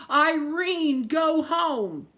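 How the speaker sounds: high, loud wind voice calling; squalling wind. Acted. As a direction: wail it like the storm. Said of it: "the wind squalled".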